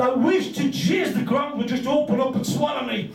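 high, rushed panic; panicked thoughts. Retold or imitated. Teller panicking in the shop queue, high rushed. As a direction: gabble like you want the floor to swallow you.

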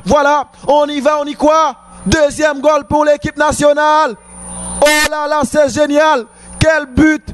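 high, shouted goal call; excited sports commentary. Real, not acted. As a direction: erupt with joy at the goal. Goal scored, commentator erupts.

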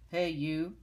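loud grouchy challenge; ladybug voice. Acted. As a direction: bark it like a grumpy bug.

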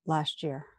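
ordinary delivery

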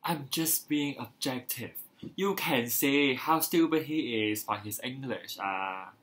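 smug. Retold or imitated. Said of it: "They say things like".

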